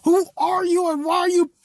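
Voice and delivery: high, loud yelp of pain and anger; high startled shout, then a demand; pained yell. Retold or imitated. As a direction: yell it like you just got poked in the eye. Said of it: "He's like".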